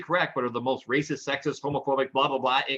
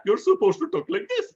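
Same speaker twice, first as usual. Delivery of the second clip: high-pitched imitation Indian accent; mock Indian accent; 5 dB louder than usual. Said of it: "he starts mocking an Indian accent".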